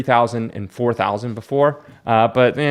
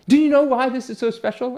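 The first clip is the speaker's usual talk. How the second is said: much higher, eager pitch; excited; 12 semitones higher than usual. Retold or imitated. Collector explaining the comic to girlfriend, excited. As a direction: sell her on the find. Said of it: "And I'm like".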